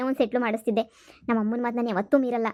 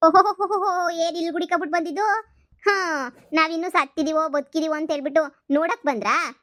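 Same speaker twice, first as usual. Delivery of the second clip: raised-pitch resentful jibe; sarcastic welcome; 5 semitones higher than usual. Acted. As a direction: greet unwanted visitors with scorn. Resentful relative greets unexpected visitors sarcastically.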